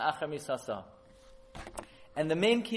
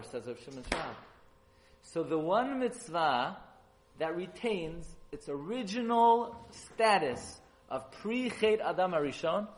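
ordinary talk

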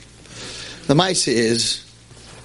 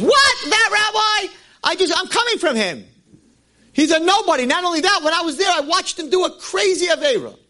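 a much higher, exclaiming stranger; a shocked traveller running the rabbi down; stranger at the inn. Acted.

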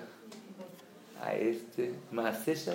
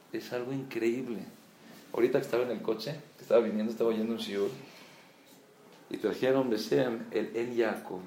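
usual speaking voice

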